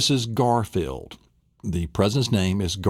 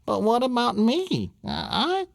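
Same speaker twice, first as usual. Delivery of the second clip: put-on high pleading child voice; be the little girl wanting candy too; small girl begging her dad; 12 semitones higher than usual.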